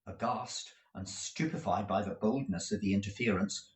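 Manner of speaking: usual speaking voice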